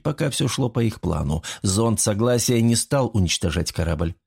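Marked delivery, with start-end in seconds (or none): none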